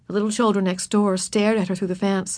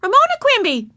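much higher, loud indignant shriek; a little girl shrieking her name; 7 dB louder than usual. A narrator's reading. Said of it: "shrieked Susan".

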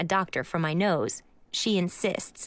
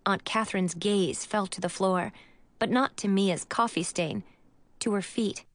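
plain reading voice

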